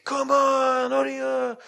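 high, drawn-out spectator shout; crowd cheering on. Retold or imitated. Onlookers urge an embarrassed runner on, imitated high-pitched calling. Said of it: "'cause the people were shouting him".

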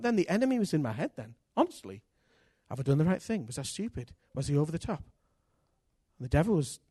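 normal talking voice

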